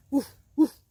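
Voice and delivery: two short, loud barks; dog's woof. Acted. Dog answering the little bird, short loud woofs. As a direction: bark back sharply, twice. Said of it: "answered the dog".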